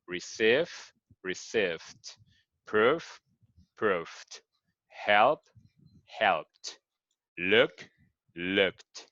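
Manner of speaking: English teacher drilling verb pairs; repeat after me drill. Shown as a demonstration. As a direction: present then past, each word modelled for repetition. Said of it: "So just repeat after me".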